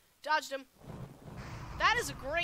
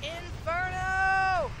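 loud, high, very drawn-out yell; long held gamer war cry; spell battle cry; 5 semitones higher, 7 dB louder, much slower than usual. Real, not acted. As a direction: bellow the spell name and hold the last vowel. Calling out a spell attack.

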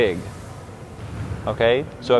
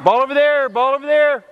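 calling out; 11 semitones higher than usual. Real, not acted. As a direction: shout the call across the court. Coach calling during the game.

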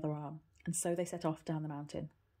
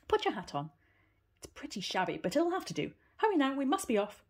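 brisk aunt; 9 semitones higher, 5 dB louder than usual. Acted. Aunt hurrying Heidi to leave.

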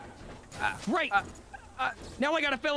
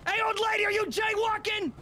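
shouting; 7 semitones higher than usual. Acted. Cop shouting at old lady.

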